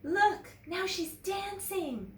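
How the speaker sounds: high, slow sneering voice; drawn-out teasing; mocking classmate. A narrator's reading. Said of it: "someone said".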